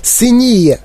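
high-pitched horrified cry; yelling. A narrator's reading. Mother sees the nose turning blue, yelling. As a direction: wail it out in alarm. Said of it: "репетувала пані Борегард".

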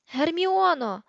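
high, loud cry for someone out of reach; bellowing. A narrator's reading. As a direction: yell the name out in desperation. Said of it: "загорлав Рон".

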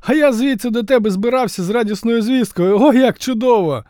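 high, loud, overjoyed; excited shouting. Acted. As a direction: shout the happy news. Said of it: "Так кричати вміг тільки він".

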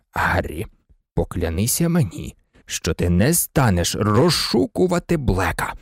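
low, solemn demand for an oath; deadly serious. A narrator's reading. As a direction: make the boy swear, gravely. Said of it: "серйознішим, ніж будь-коли голосом". (серйозно, низьким голосом)

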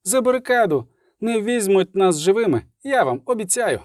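high-pitched rallying orders; commander's call. A narrator's reading. Райла rallying soldiers behind the barricade, high commanding call. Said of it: "грикнула Райла".

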